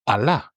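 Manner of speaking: Allah said a-LA, final a stressed; stress on final syllable. Shown as a demonstration. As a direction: hit the end of Allah. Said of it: "the right one is Allah".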